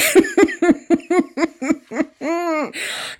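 long high cackle of delight; real laughter. Real, not acted. Reacting to the welcome-mat insult, long delighted laugh. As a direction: let a big real laugh roll out.

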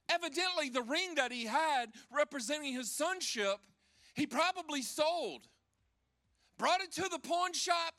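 high, loud fired-up preacher voice; preaching at full force. Acted. Preacher to congregation retelling the father's welcome, fired up. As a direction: drive the story home from the pulpit. Preaching the prodigal son story.

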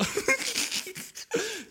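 quick high giggle, soft; high breathy laugh. Real, not acted. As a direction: giggle it off lightly. Laughs at her own rant.

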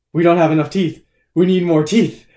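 put-on loud, emphatic kid's declaration; imitated movie kid. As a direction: mimic a kid announcing it urgently.

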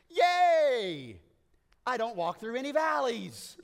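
high, loud and bubbly; giddy cheer. Pretend. Preacher mocking how we'd rewrite the psalm, giddy and loud. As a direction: cheer it like a giddy teen texting. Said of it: "that's how you and I would write it".

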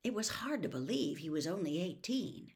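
usual speaking voice

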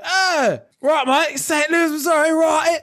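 high mock Aussie drawl; Australian accent. Aussie repeating Saint Louis, high mock Australian accent. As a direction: play the Aussie nodding along. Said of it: "He's like".

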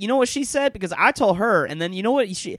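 a breathless gossip who can't wait to share; gossiping woman. Retold or imitated. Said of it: "an impression of a woman talking about a woman".

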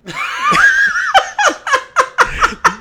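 high-pitched incredulous laugh; loud disbelieving laugh; shrieking laugh. Real, not acted. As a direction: burst out in a squealing cackle. Laughs at the excuse.

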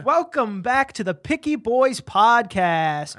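hyped host opener; show intro. Acted. Show intro announced in put-on voice.

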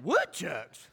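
a baffled, low "Woodchucks?" with a laugh; surprised southerner echoing "Woodchucks"; puzzled echo. Retold or imitated. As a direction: repeat "Woodchucks" as if you've never heard it. Puzzled echo of the strange word.

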